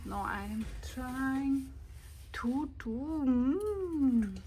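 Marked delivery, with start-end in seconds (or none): none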